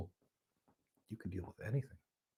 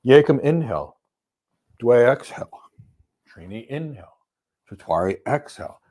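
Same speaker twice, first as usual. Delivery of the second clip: counted yoga breath; 4 dB louder than usual. Shown as a demonstration. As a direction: call each breath like a yoga teacher counting. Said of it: "it'll be something like this".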